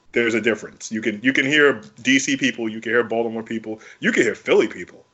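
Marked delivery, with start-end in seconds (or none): none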